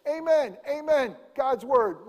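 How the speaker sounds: high, loud ringing amen; loud proclamation. Retold or imitated. Jesus opening his teaching with a high loud call. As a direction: proclaim it over the crowd. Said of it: "Jesus starting off by saying".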